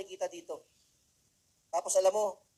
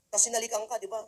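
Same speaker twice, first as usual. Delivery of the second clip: usual speaking voice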